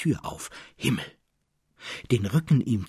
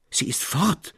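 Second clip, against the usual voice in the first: despairing cry; 7 semitones higher than usual. A narrator's reading. Traugott cries out in despair to Elias Roos. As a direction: cry out the loss. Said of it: "So schrie er".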